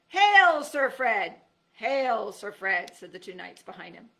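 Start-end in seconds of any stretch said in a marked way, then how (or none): 0.1-1.4 high, loud booming greeting; a knight hailing a hero; knightly hail. A narrator's reading. Lead knight hails Fred, loud and high. 1.7-2.9 loud greeting; echoed hail. A narrator's reading. Two knights echo the hail, loud.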